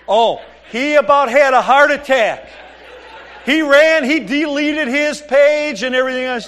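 excited mock-breathless report; gleeful retelling. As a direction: blurt it out like the prank was a triumph. Daughter gleefully retells prank result.

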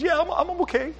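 very high imitation of a tearful girl; put-on high voice, pretending to be okay; mock tearful. Girl hand on chest answering a stranger, mock tearful.

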